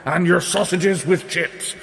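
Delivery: shouting giant. A narrator's reading. Giant threatens Jack during the chase, cross shouting. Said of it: "when he shouted".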